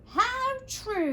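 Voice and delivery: high kangaroo voice; big kangaroo. Acted. Kangaroo agreeing with the elephant, high character voice. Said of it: "said the big kangaroo".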